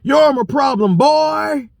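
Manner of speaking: high, sneering voice for the other guy; mocking the other driver. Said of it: "here's his response".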